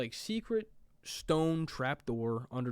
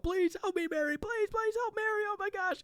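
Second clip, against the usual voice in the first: mock scream. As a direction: squeal it like a panicked plea, but hammed-up. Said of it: "He's screaming out".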